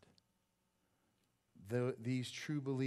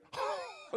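high laugh. Real, not acted. Laughing at his own story.

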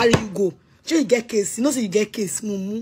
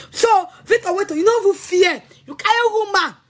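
higher and louder, breaking into tears; crying out; 8 semitones higher, 3 dB louder than usual. Real, not acted. Accusing Victor of taking the woman, tearful outcry.

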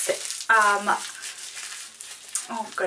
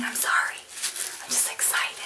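quiet whispered apology; softer whispered excuse; sheepish whisper; 8 dB quieter than usual. Real, not acted. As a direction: drop to a whisper, embarrassed. Apologising for yelling, hushed.

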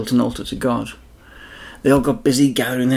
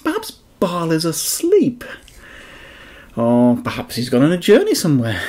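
mocking; 5 semitones higher than usual. Elijah mocking the prophets of Baal, mild mock sympathy. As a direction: poke fun at their sleeping god. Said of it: "mocked Elijah".

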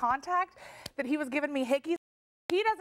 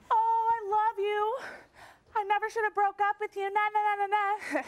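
mock whining; 6 semitones higher than usual. Guest mimicking her rival's pleading phone call, mock whiny. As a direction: imitate a lovesick ex whining down the phone. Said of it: "She calls up whining".

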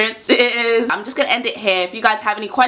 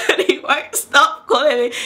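higher-pitched, laughing through the words; 5 semitones higher than usual. Real, not acted. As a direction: tell it through a giggle. Laughing while telling the story.

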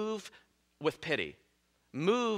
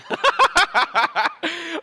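long real laugh, much higher; embarrassed laugh; 14 semitones higher, 6 dB louder than usual. Real, not acted. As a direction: crack up at your own joke. Laughs at own joke.

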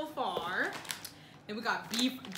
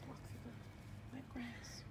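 ordinary talk

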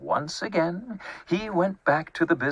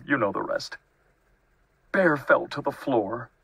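plain reading voice

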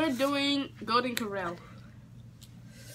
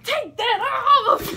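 loud, high yell; shouting; 12 semitones higher, 12 dB louder, slower than usual. Real, not acted. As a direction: yell it out sharply. Yelling at Clem.

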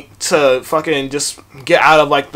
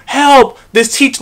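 joking, hammed-up cry of help, a touch higher; mock cry for help; 7 semitones higher, much slower than usual. Teen mocking the teacher holding him, hammed-up help cry. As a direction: play up a fake help call for laughs. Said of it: "just making a joke out of it".

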